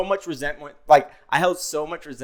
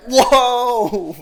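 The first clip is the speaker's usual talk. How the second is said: stunned whoa; 7 semitones higher, 3 dB louder than usual. Retold or imitated. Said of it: "I'm like".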